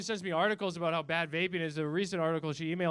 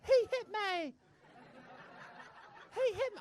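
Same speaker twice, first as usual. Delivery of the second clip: put-on high-pitched little English kid voice; whining British child; 13 semitones higher, 5 dB quieter than usual. Child tattling on the bus driver, high whine. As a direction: squeak it out as a lying child's complaint. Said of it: "That's not the sound of a child".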